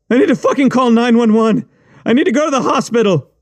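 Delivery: high, fast frantic pleading; panicked pleading. Acted. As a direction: beg to get help now. Panicking wounded writer.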